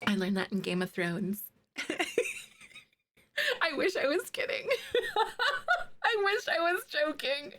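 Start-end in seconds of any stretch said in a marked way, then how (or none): 3.6-7.5 giggling through the words; laughing at herself while she admits it. Real, not acted. Streamer to chat, laughing at herself. Laughing at own Game of Thrones.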